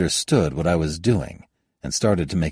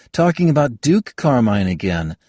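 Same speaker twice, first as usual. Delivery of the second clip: put-on higher, louder, exasperated young woman; young woman scolding; 11 semitones higher, 6 dB louder than usual. Kayada scolds Halbert for having no opinion, higher and louder. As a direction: cut him down with rising heat.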